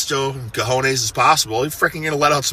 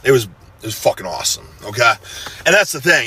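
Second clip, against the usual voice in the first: ordinary delivery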